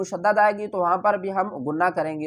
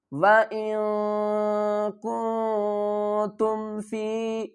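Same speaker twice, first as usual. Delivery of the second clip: slow, drawn-out chanted recitation; Quran recitation; 4 dB quieter, much slower than usual. Shown as a demonstration. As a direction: recite it slowly and melodically. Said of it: "یہاں پر اخفا کرنا ہے". (آہستہ، ترتیل سے تلاوت)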